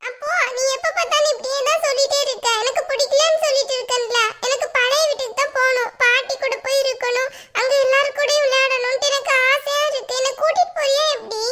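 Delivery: put-on high-pitched little-kid pestering; insistent whiny child. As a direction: demand it like a child who won't give up. Child figure nagging mother.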